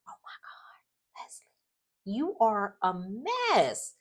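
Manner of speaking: hushed whisper, dragged slow; whispered aside. Real, not acted. Vlogger whispering about Leslie, slow and scandalized. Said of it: "I said".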